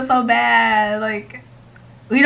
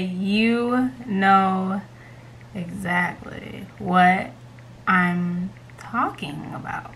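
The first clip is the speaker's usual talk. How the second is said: slow, spaced-out words while typing; composing aloud; 4 semitones lower, 6 dB quieter, much slower than usual. Real, not acted. Typing a prank text to her best friend, slow and drawn out. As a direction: drag it out as you type the message.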